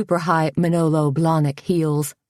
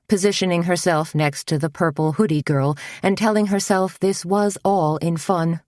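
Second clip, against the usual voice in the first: ordinary delivery, nothing marked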